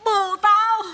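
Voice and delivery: high, stunned blurt; shocked realization. Acted. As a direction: let the awful discovery burst out. Recognizes friend's husband as lover. (thốt lên cao giọng, sửng sốt)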